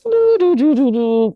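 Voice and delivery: singsong doo-doo-doo starting high and dropping; idle little tune mimicking someone in no hurry; carefree doo-doo humming. Hospital staff with no sense of urgency, carefree doodling. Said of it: "no sense of urgency".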